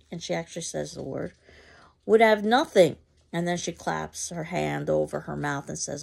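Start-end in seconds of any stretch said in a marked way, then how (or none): 2.1-3.0 loud, high blurt. Acted. Mrs. Johnson blurting her slur, loud. Finishes loud boasting line.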